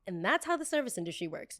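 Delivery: ordinary delivery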